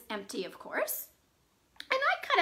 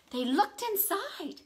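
normal talking voice

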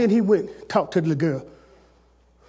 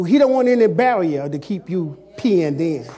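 normal talking voice

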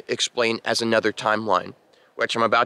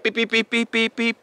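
higher-pitched mimicked beeping; imitating beeps; 10 semitones higher than usual. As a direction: chirp out the beeps like a signal. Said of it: "I'm hearing beep".

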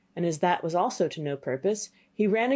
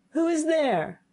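high startled call up the tower; cried out; 9 semitones higher, slower than usual. Acted. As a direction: call out into the dark, higher. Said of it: "cried he".

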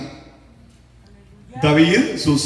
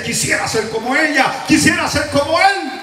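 admiring quote; 9 semitones higher than usual. Retold or imitated. Pastor voicing admiring people.

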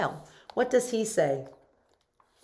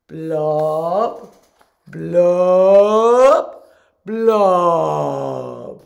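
loud fish blubs; gurgling fish man croak; 12 dB louder, much slower than usual. Acted. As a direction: bubble it out loud. Fish blub refrain.

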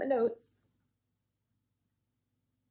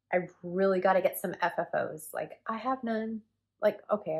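usual speaking voice

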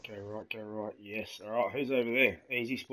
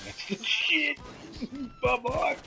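high-pitched, laughing cheer; laughing glee; slower than usual. Real, not acted. Player cheering the kill to his mates, laughing hard. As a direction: crow it through helpless laughter. Gleeful laughter during game kills.

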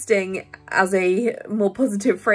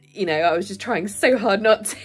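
ordinary talk, nothing marked

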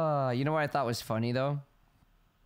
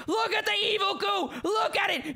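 frantic shouting. Real, not acted. Player yelling at the evil goo creature grabbing him. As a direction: yell in outraged alarm.

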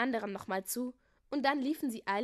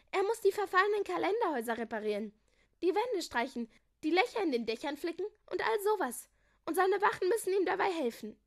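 put-on high, louder child-like voice; eager girl; 8 semitones higher, 5 dB louder than usual. A narrator's reading. Julia listing Leo's punishment, high and eager. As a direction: rattle off the chores eagerly.

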